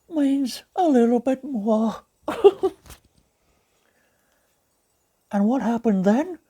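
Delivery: gentle cartoon bear narrating; Pooh Bear voice. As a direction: read it as a gentle honey-loving bear. Pooh Bear reads the story.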